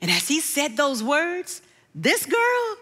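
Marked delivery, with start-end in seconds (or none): 2.0-2.8 a sudden high sobbing wail, breaking; woman overcome by a song, wailing; crying wail. Real, not acted. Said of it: "I wailed".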